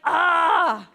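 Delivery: loud, high "aah" of frustration; wordless yell, at the end of your rope; exasperated yell. Retold or imitated. Mum losing patience with the children, exasperated yell. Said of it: "I was just like".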